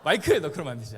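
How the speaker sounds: loud, high yell of the name; scolding shout. Retold or imitated. Pastor scolding Michael for teasing, loud shout. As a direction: bellow at the boy to stop. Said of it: "제가 혼냈죠".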